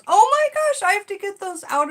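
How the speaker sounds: very high, loud shriek of oh no; startled alarm. Real, not acted. Realising she keeps dogwood indoors, alarmed shriek. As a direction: panic at what's in your house. Dogwood blooms in her house.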